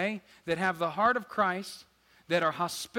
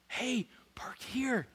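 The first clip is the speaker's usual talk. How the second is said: slow deadpan whisper of a parking greeter; mock the excitement by whispering it slowly; parking-lot volunteer, joke excitement, whispered; ironic excited greeter; 6 semitones higher, slower than usual. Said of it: "That's the excited person".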